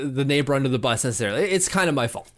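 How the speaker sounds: ordinary delivery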